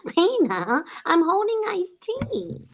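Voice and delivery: slow high cartoon wife voice; Lois impression. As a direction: whine it like a cartoon wife holding drinks. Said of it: "And she's like".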